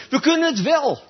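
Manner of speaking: high, cocky protest; defiant crowd. Retold or imitated. Israelites answering Joshua, high and defiant. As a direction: toss it back like a sure-of-themselves crowd. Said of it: "weet je wat ze zeiden".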